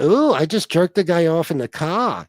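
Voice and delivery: mock a Providence accent with dropped R; hammed-up New England voice, "car" as "cah". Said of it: "He's got that New England accent".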